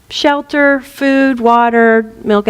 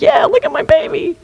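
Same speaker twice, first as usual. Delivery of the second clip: put-on much higher, proud mother showing off her baby; proud mom voice. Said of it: "And the mom's like".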